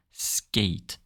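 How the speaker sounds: normal talking voice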